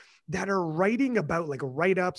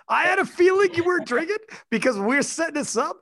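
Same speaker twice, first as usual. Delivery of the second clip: a much higher, louder teasing call-out to the guest; playful accusation; 11 semitones higher, 5 dB louder than usual. Real, not acted. Host ribbing the Irish guest who isn't drinking, teasing call-out. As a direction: rib the guest for not drinking.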